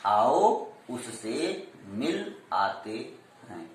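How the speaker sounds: slow, low and soft, a gap after each of आओ, उससे, मिल; measured demo reading of आओ उससे मिल आते हैं, word by word; juncture pauses. Shown as a demonstration. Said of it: "यहाँ मिल".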